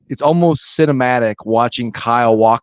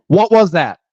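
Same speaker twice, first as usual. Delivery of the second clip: mock confrontational challenge, pitched up; slightly raised, play-acted demand; playing an aggrieved driver; 5 semitones higher than usual. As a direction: act out picking a fight. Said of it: "I'm Ricky".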